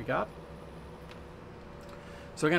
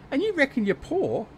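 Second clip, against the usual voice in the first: incredulous; 12 semitones higher, 4 dB louder than usual. Real, not acted. Repeating the tease, bigger.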